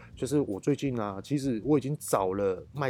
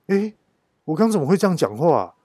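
startled self-questioning, raised and louder; sudden realization; 5 semitones higher, 8 dB louder than usual. Retold or imitated. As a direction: catch your own mistake out loud. Suddenly realizing his own ordering slip.

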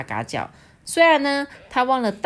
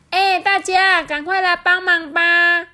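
much higher, louder, slow call out; calling for help; 11 semitones higher, 6 dB louder, slower than usual. A narrator's reading. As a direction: call everyone over to help. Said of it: "乌鸦就说". (大声呼叫大家帮忙)

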